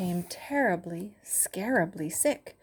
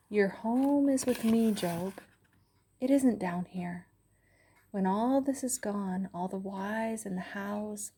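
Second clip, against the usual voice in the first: voice of God. Acted. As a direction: speak as God, calm and vast, softening toward the end. God speaks to Job.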